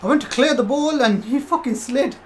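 high, loud indignant outburst; outraged yell. Real, not acted. Streamer yells at the opponent's slide, high and loud. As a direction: explode at the unfair tackle.

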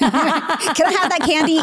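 put-on high squeaky little kid voice, laughing; child asking for candy. Mom voicing a child begging for candy. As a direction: play the pleading child.

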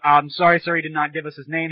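usual speaking voice

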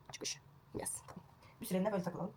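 breathy aside, barely voiced; soft whispered aside while combing. Real, not acted. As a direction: whisper it to yourself.

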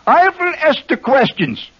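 high, hammed-up German interrogator; mock German accent. Host delivers knock-knock punchline, high mock German accent. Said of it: "How'd you like my accent?".

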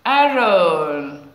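loud, drawn-out announcement of the name; excited reveal. Real, not acted. Teacher announcing the star of the week, excited. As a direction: call the winner's name out proudly.

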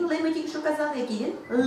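usual speaking voice

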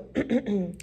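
acted throat clear; little preparatory ahem. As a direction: clear the throat importantly, like a professor. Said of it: "Stuart cleared his throat".

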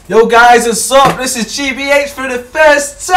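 loud, high YouTuber greeting; over-the-top vlog opener; hyped intro. Acted. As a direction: shout the intro like a hype vlogger.

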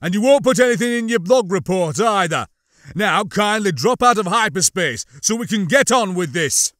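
loud, high, forceful demand; growled orders. A narrator's reading. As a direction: order it impatiently, posing as an ISB thug. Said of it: "Larone growled".

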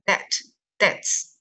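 "debt" said slowly as "det", no b; silent b. Shown as a demonstration. Teacher shows the letter is not pronounced, silent-b demo. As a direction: say "debt" and "debts" dropping the b entirely. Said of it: "直接不发音".

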